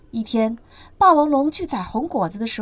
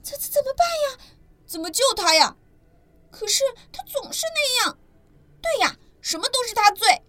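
much higher voices, stumbling; scared little dinosaurs unsure what to do; frightened baby dinosaurs; stammering panicked babies; 12 semitones higher than usual. Acted. Siblings panicking.

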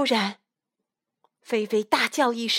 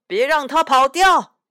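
loud urgent call; shouting; 5 semitones higher, 8 dB louder than usual. A narrator's reading. Another person at the show shouting to catch the dog, loud. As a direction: yell to stop the dog getting away. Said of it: "另一个人喊着".

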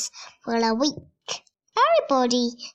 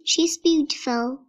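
plain reading voice

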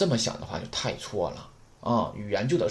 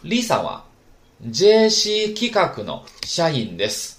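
model reading; 5 semitones higher, 6 dB louder than usual. Shown as a demonstration. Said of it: "那看一下例子啊".

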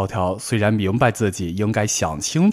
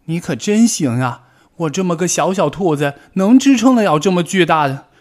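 much higher, louder indignant rabbit; furious; 9 semitones higher, 6 dB louder than usual. Acted. Bengbeng to Tiaotiao, furious and indignant. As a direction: rise in outrage at the unfair blame. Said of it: "蹦蹦气坏了".

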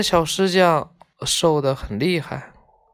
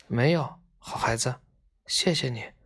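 softly voiced weary reply, trailing lower; subdued thanks; 3 semitones lower, 6 dB quieter than usual. A narrator's reading. Father declining a kind boy's help, subdued and soft. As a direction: answer gently, very softly.

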